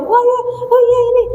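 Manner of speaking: high-pitched flustered mum imitation; fussing mother. Mother attending to her crying small child, fussing. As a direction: play a mother rushing to her baby.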